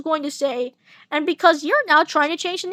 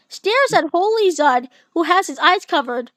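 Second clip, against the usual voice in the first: usual speaking voice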